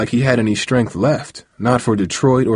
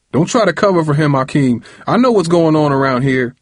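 higher, insistent reprimand; fed-up uncle, voice raised in pitch; angry scolding; 6 semitones higher, 3 dB louder than usual. A narrator's reading. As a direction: cut the boy off sternly. Angry uncle cuts off the boy.

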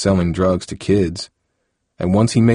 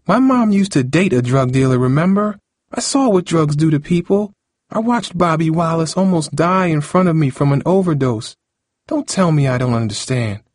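high-pitched, upset argument; upset, insistent girl's tirade; heated outburst; 9 semitones higher than usual. Acted. Cindy's angry outburst at Harold.

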